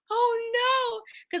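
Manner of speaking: high-pitched oh no; mock dismay. Realizing her mistake with [name], high mock dismay. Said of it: "And I was like".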